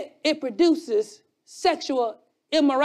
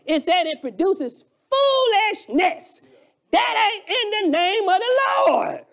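preaching at full force; 6 semitones higher than usual. Acted. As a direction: preach it hard to the congregation. Preacher builds again.

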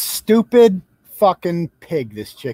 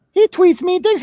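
higher, louder mock shock; sarcastic mock alarm; 9 semitones higher, 4 dB louder than usual. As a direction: fake gasp of outrage. Mocking Biden voters' complaints.

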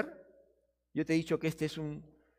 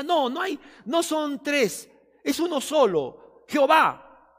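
loud, higher, insistent shout of denial; mock objector shouting; 7 semitones higher, 8 dB louder, slower than usual. Objector quoted denying the Trinity.

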